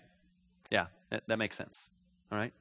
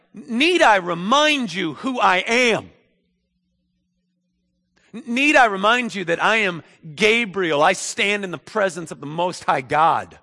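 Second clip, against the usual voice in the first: loud, raised, affronted angel; indignant; 6 semitones higher, 6 dB louder than usual. Acted. Gabriel reminds doubting Zechariah who he is, indignant. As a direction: pull rank with offended force. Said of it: "Gabriel goes indignant by all this".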